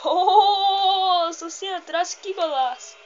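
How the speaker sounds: long, loud cry on the first word; amazed near-miss reaction. Real, not acted. Gamer seeing a near golaço, loud amazed cry. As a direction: roar out the first word, then exclaim loudly.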